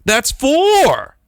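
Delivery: triumphant. Retold or imitated. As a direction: announce it like a victory. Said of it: "and I said".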